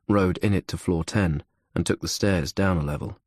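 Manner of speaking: usual speaking voice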